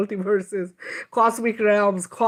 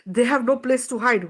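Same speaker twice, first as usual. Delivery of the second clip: ordinary talk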